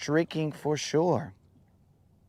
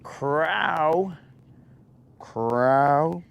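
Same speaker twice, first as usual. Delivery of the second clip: louder, drawn-out Southern rooster crow; southern drawl rooster; 2 semitones higher, 4 dB louder, much slower than usual. Retold or imitated. Picturing Southern roosters with a Southern drawl, crowing louder. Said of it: "that southern drawl".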